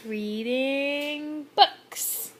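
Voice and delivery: very slow, drawn out, each word clear; slow modeling for a toddler. Shown as a demonstration. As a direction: spell it out slowly for a toddler to copy. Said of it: "Can you say".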